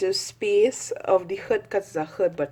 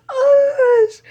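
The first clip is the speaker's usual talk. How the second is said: high, loud gushing cry; excited squeal; 12 dB louder than usual. Real, not acted. Fan gushing over Jazza, squealing excitedly.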